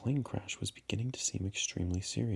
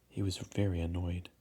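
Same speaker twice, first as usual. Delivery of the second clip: usual speaking voice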